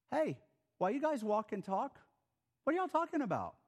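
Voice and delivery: breezy, chummy greeting rising high; casual stranger. Retold or imitated. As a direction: barge in like a friendly stranger. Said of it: "he says this".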